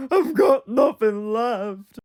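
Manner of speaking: loud, slow, hammed-up cry; mock despairing wail. Mimicking a soap character with nothing left, wailing. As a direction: wail it out as if all is lost.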